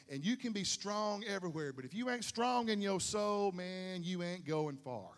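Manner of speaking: ordinary talk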